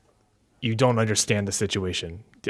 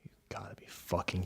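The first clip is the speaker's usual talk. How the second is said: whispering; 3 semitones lower, 14 dB quieter than usual. Acted. GM exasperated at party's arrival.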